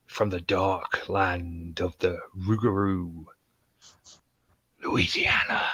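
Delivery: slow, hushed, ominous intro that sinks into a whisper; spooky announcer. Acted. Host introducing Chubbs from Louisiana, in a slow spooky hush. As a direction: drag it out like a campfire ghost story.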